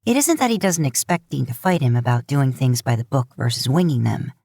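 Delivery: usual speaking voice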